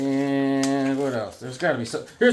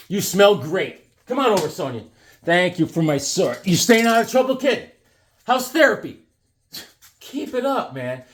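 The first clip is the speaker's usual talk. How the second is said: schmoozing host; 6 semitones higher than usual. Pretend. Greeting the Sonia figure from the package, cheesy schmoozing. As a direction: flatter her like a cheesy uncle.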